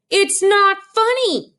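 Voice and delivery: put-on boy repeating his protest to mother, slow and whiny; upset child. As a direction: drag it out, wounded. Child protests again, louder.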